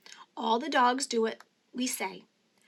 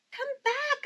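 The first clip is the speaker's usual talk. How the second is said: high, sharp call to come back; shout. A narrator's reading. Nancy shouts after the runaway dog. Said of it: "I shout".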